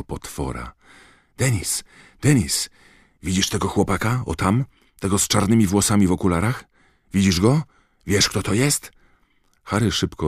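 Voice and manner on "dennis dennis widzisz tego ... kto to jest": put-on higher, fast, eager boy; excited whisper-shout. A narrator's reading. Colin eagerly points Harry out to Dennis. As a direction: nudge your brother, bursting to tell.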